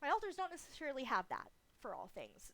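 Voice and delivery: ordinary delivery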